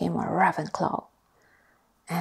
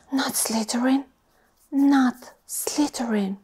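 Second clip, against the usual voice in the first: urgent whispered plea; pleading thought; 4 semitones higher, 4 dB louder than usual. Acted. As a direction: beg silently, over and over. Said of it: "and thought".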